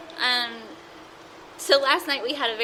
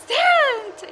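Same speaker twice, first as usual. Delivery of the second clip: much higher and louder joyful call; delighted greeting call; 5 dB louder than usual. Retold or imitated. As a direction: greet a friend you've missed. Greeting friend off the elevator.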